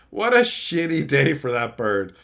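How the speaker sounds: ordinary talk, nothing marked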